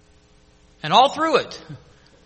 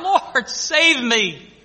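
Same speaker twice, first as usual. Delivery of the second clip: mock cry for help; 6 semitones higher, 5 dB louder, much slower than usual. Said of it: "he cried out".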